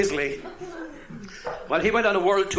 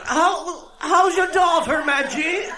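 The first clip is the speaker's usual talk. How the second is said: put-on high, slow calling old woman; old Cork woman; 11 semitones higher, 5 dB louder, much slower than usual. Old woman calling to her friend over the partition, high and slow. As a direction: call over the toilet partition. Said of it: "one said to the other".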